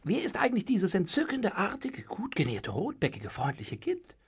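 softly admiring question, lingering over compliments; curious. A narrator's reading. Servant eyeing the pretty maid, curious. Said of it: "fragte neugierig".

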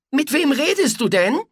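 a mother peeking in, curious; mother's voice. A narrator's reading. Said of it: "Alexanders Mutter schaute ins Zimmer".

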